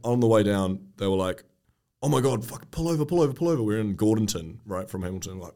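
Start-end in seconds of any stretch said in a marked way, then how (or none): 2.0-3.6 urgent retold plea. Retold passengers urgently calling out.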